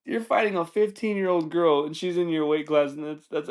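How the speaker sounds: high-pitched, incredulous, fresh out of a laughing fit; cracking up in disbelief. Real, not acted. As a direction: say it through the tail of a big laugh. Cracking up at his own story.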